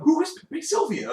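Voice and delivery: high-pitched mock frenzy; hammed-up high, incredulous cry; manic quote. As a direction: squeal it like a baffled crazed man. Quoting a manic sitcom character.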